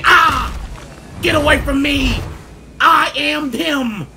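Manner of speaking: high, loud, drawn-out yell; fighting shout. Real, not acted. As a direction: holler it while fending someone off. Fighting off a follower.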